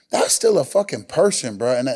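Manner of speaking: ordinary talk, nothing marked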